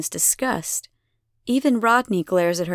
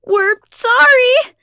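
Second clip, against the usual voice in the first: high, loud, drawn-out scream; screamed apology; 10 dB louder, slower than usual. A narrator's reading. Abigail pleads from inside the flames, screaming. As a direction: scream it slowly over the roar. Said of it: "Abigail screams through the tornado of flames".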